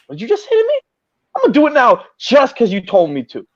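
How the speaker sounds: high indignant yelp, then cocky; petty defiance. Retold or imitated. A petty kid defying whoever told him not to, mock indignant. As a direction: squeak in mock outrage, then dare them. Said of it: "I'm be like".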